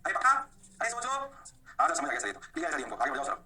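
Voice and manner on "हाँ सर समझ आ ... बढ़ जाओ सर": lightly voiced lower pupil; student reply. Student telling sir to move on, lightly voiced student reply. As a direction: answer low as an agreeable student.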